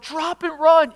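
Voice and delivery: high, loud, quick command; re-enacted yell. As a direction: call out the warning as the father. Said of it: "If I would have yelled".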